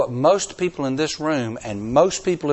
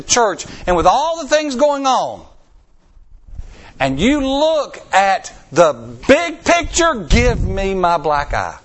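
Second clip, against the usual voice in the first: loud, high preaching at full force; fiery preaching; 6 semitones higher, 5 dB louder than usual. Acted. Preacher to congregation, loud rising preaching. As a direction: drive it home to the congregation.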